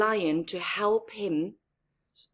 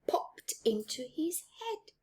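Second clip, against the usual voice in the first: whisper; 7 semitones higher, 7 dB quieter than usual. A narrator's reading. Story narration hushed.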